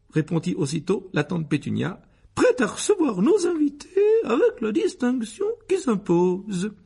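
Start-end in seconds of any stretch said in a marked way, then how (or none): 2.3-6.7 very high, loud, gushing aunt; eager Aunt Petunia. A narrator's reading. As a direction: answer instantly in an affected high voice. (tante empressée, voix très aiguë)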